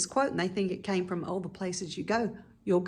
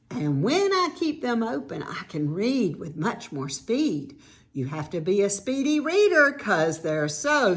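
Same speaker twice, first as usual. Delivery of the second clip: lively, lilting picture-book reading with big pitch swings; sing-song children's story narration; read-aloud to children; 4 dB louder than usual. A narrator's reading. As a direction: read a rhyming storybook to kids, stretching line ends.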